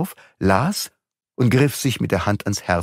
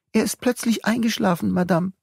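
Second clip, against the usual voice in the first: nursemaid's voice; 12 semitones higher than usual. A narrator's reading. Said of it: "sagte das Kindermädchen".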